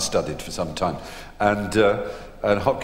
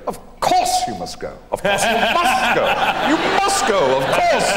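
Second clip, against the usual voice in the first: from breathless whisper to much higher, louder, laughing mimicry; mocking Olivier; 14 semitones higher, 6 dB louder than usual. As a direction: sneer the same kind words back as a gleeful mocking echo. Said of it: "he hears Olivier's voice in his dressing room, going".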